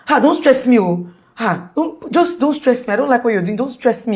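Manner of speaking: irritated rebuke. Retold or imitated. Husband telling his wife not to stress him, irritated.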